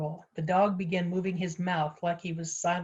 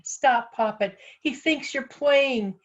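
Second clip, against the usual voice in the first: raised, higher urgent yell; urgent shouted plea, building; 6 semitones higher, 5 dB louder, slower than usual. A narrator's reading. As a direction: yell a warning after someone running. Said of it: "gasping and yelling".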